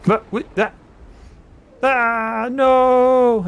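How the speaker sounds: high, very loud wail of no; horrified outcry. Acted. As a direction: build to a long, high, hammed-up cry. Acts out a horrified scream.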